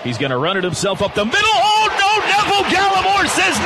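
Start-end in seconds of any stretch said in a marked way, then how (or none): 1.4-3.6 excited call. Real, not acted. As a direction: sell the big hit. Hard hit coming, live call.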